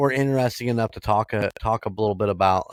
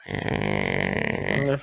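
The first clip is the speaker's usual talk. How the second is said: unimpressed grunt; 14 semitones lower, 5 dB quieter than usual. Retold or imitated. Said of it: "You go".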